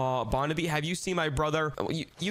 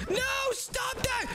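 high, drawn-out desperate cry; panicked scream; much slower than usual. Acted. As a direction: scream to stop it. Tries to stop Dad, falls.

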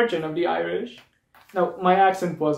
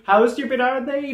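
crowd call-out; 7 semitones higher than usual. Shown as a demonstration. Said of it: "Say it with me".

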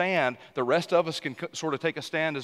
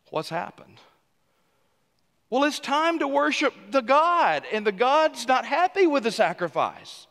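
fiery preaching; 8 semitones higher than usual. Acted. Pastor challenging the congregation about anger, preaching hard. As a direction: hammer home the worship problem.

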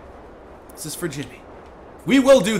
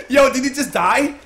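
ordinary talk, nothing marked

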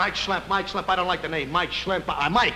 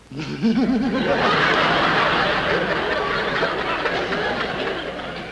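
breathless starstruck giggling; giddy giggle; 3 semitones higher than usual. Acted. Recruit meeting a film star, starstruck giggle. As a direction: giggle nervously.

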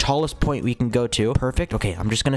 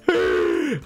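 short, high squeal of fright; panicked squeal; 11 semitones higher than usual. Real, not acted. Player caught by the wave, panicked squeal.

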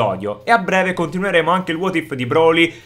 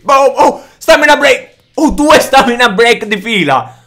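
excited shout; 8 semitones higher, 7 dB louder than usual. Real, not acted. Hype reaction to combo.

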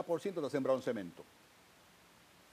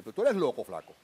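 a touch of a janitor's scolding, louder; half-laughing mock scold; imitating the janitor calling him crazy; 7 dB louder than usual. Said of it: "y me decía".

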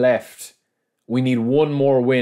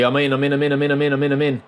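rapid-fire excited chant, slightly raised; rising excitement; 4 semitones higher, faster than usual. Real, not acted. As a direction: rattle it off as the chance opens. Breaking through towards goal.

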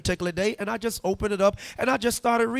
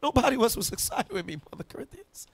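ordinary talk, nothing marked

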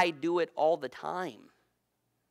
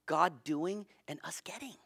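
hushed whisper of the key point; 2 semitones higher, 6 dB quieter than usual. Real, not acted. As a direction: lean in and whisper it.

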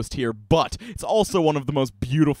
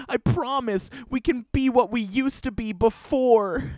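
put-on girly pleading girlfriend; 7 semitones higher than usual. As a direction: beg him back like a sweet clingy girlfriend. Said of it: "get him back in the nice, girliest way".

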